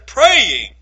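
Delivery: Praying called out loud and falling; emphatic key word. Acted. Preacher spotlighting prayer for the congregation, emphatic. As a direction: land "Praying" hard, then pause.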